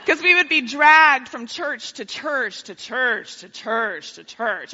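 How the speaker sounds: slow, tired repetition; weary slow drag. Retold or imitated. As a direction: drag it out like a bored kid. Dragged church to church.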